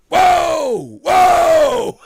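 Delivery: loud, high, drawn-out mock shock; hammed-up stunned shout; shocked whoa. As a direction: yell it out in exaggerated astonishment. Acting shock at clerk's accent.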